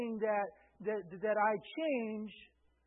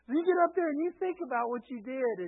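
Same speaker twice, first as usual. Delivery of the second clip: mock angry parent; 4 semitones higher, 6 dB louder, faster than usual. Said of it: "I was angry".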